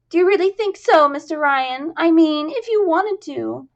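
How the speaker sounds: high, loud, squeaky hope; squeaky chorus girl. Acted. Jasmine hoping Ryan will help, high and squeaky. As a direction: squeak it out, hopeful. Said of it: "mid-squeak".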